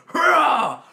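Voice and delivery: huge high roar, hyping yourself up; amping himself up. Retold or imitated. Big guy psyching up to carry his friend, high roaring battle cry. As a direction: roar like a man psyching up for a lift. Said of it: "he's fucking amping himself up".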